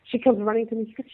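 ordinary talk, nothing marked

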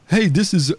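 put-on African accent. Scammer phoning a shop owner, mock African accent. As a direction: greet in an imitated African accent. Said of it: "like, kinda African or something".

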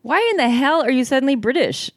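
high-pitched exasperated disbelief; incredulous. A narrator's reading. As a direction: demand an explanation, voice jumping up. Character reacts to friend's fake accent.

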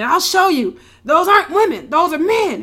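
loud, high imitation of hysterical insisting; frantic mock protest. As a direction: cry it out to prove your point. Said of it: "he's, like, hysterical running off".

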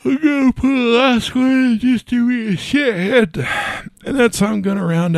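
talking through a yawn; words stretched by a big yawn. Real, not acted. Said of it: "sorry for the yawning".

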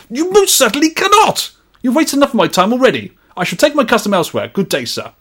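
high, fast, outraged Victorian gentleman. Acted. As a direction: storm off as an offended Victorian gentleman. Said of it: "best read in a Victorian gentleman's accent".

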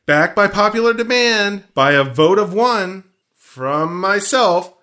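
announcer voice. Pretend. Mock announcement of theory.

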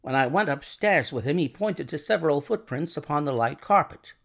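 ordinary delivery, nothing marked

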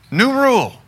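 loud, high firm decree; firm announcement. Retold or imitated. Husband announcing a new rule to his wife, loud and firm. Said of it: "I've told my wife, I said".

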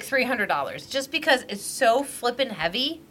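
ordinary talk

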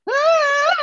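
very high, loud mock shriek; high startled cry. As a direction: let out a shocked little scream. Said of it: "the lady and her back is like".

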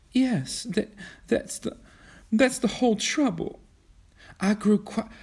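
high, broken, tearful voice; weeping. A narrator's reading. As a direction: stammer through the tears. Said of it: "Kismene began to weep".

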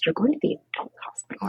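quick, breathy hushed line; retold whisper of the doctor; hushed retelling. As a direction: murmur it fast under the breath. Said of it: "He goes".

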